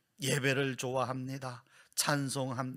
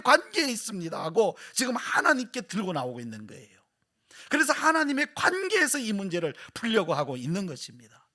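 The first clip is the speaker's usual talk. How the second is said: higher, louder preaching that drives every phrase; full-force preaching; 9 semitones higher, 9 dB louder than usual. Acted. Pastor to congregation about the sufferer, full-force preaching.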